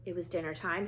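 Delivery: ordinary delivery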